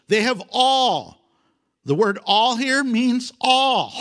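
scripture reading. Acted. Pastor reading verse twelve, proclaiming slowly.

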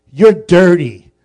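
loud, slow, dragged-out punchline; blurted insult. Acted. Guard tells Pilate the crowd's message, loud and slow. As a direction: land the insult big and slow.

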